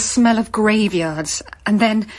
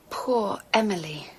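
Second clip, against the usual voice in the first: softer, sighed and whispered; sighing whisper; 4 semitones lower, 7 dB quieter than usual. Acted. Grieving for Emily on finding the body, sighed whisper. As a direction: let the pity sigh out. Realizing the dead man's fate.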